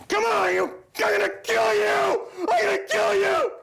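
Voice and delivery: hammed-up murderous banker yelling; mock thug screaming threats. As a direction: shriek the threats like a crazed mobster. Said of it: "my impression of R B S doing business".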